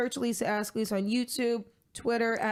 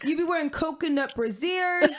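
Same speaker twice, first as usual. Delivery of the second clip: excited; 8 semitones higher, 4 dB louder than usual. Real, not acted. Joking excited shout-out.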